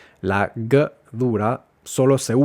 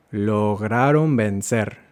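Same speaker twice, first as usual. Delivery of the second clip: slow careful model; slower than usual. Shown as a demonstration. Teacher modelling a phrase for students to repeat. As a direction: model it slowly for learners. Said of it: "pronunciar estas frases conmigo".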